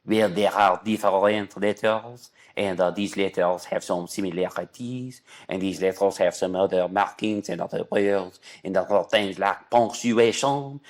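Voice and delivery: mock a long-winded French expert; better French accent. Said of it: "can I do a better French accent than that?".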